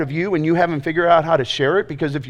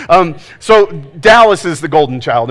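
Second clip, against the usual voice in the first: usual speaking voice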